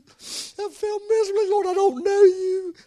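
high, strained cry to the Lord; anguished confession. Retold or imitated. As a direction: plead it in distress, pitched well up. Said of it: "That's the way I felt at that moment".

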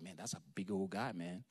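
low, soft musing aside; hesitant private thought. Acted. David looking at the giant, hesitant private thought. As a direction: think it aloud, low and quick. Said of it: "looking at that guy and be like".

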